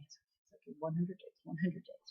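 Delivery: very soft, under the breath, acted; calming himself down. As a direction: talk yourself down in a hushed voice. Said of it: "trying to calm down".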